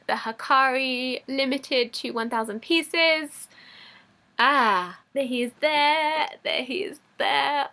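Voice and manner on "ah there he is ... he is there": ordinary talk, nothing marked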